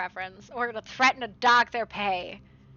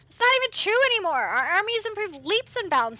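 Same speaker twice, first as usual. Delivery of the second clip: higher, louder and fast indignation; indignant protest; 8 semitones higher, 4 dB louder than usual. Real, not acted.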